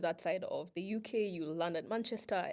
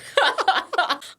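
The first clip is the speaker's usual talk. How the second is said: high laugh; 10 semitones higher, 14 dB louder than usual. Real, not acted. Laughing hard.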